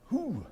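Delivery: short, high-pitched "whew"; fired-up whoop. Retold or imitated. Retelling the young candidate arriving at OCS, mock fired-up whoop. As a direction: imitate an eager recruit's whoop. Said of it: "he's fired up".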